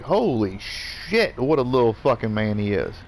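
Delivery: re-enacted, lingering astonishment; amazed reaction. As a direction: stretch both words in mock amazement. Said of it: "I was like".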